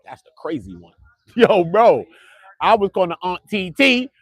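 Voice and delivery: usual speaking voice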